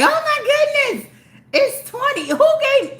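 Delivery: high squeal of fake excitement, laughing; mock excited squeal. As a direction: play the giddy fool hearing a number, pitched high. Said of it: "I'm gonna be like".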